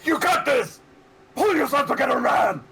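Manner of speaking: high, loud rallying shout; motivational yell. Acted. Player rallying a wounded ally, motivational yell. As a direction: yell it like a tough pep talk.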